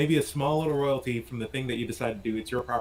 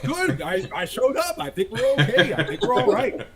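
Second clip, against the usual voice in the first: high, laughing mock reassurance; giggly churchgoer shrugging it off; mock congregant, laughing; 10 semitones higher than usual. Mock churchgoer replying to priest, high and laughing. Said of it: "it's like".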